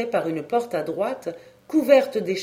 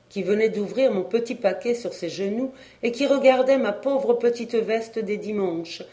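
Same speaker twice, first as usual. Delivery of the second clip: usual speaking voice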